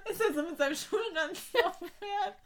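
high, squeezed out through real laughter; giggling fit running through the words; laughing. Real, not acted. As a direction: talk while helplessly cracking up. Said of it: "Wahrscheinlich lacht gerade niemand außer uns".

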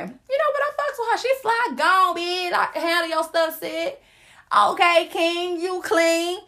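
playful sassy cheerleader of her friends; hype friend. Pretend. Hyped-up voice praising friends' posts.

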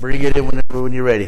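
loud call across the room, relaxed. Real, not acted. [name] calling Frankie over to the mic, loud relaxed call.

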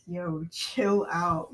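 slow chill out; drawn-out yo, chill out; slow, drawn-out calm-down. Pretend. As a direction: stretch out chill out, calmly. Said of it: "I'd be like".

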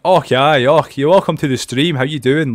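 broad Scottish accent. Shown as a demonstration. Streamer showing how he doesn't sound, broad Scottish accent. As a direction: greet viewers in a thick Scottish brogue. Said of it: "it doesn't sound like ... not like this kind of bro".

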